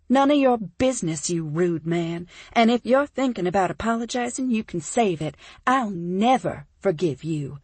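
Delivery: indignant outburst. Acted. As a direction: tell him off, nearly shouting. Heroine lashes out at him.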